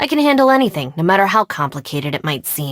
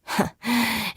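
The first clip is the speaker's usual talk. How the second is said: soft laugh; 4 semitones higher, 8 dB quieter than usual. Acted. Amused recalling sparring friend.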